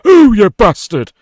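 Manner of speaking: high, slow, drawn-out "Ooh, you bastard!"; buzzer sound shout. Shown as a demonstration. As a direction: belt it out like a game show buzzer. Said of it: "your buzzer sound is".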